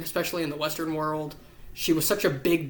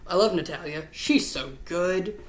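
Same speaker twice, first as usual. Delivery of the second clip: normal talking voice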